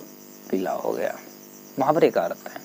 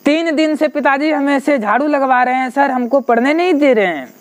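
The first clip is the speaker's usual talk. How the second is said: crying complaint; 7 semitones higher than usual. Retold or imitated. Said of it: "पूरा बहुत रोते हुए हमने कहा".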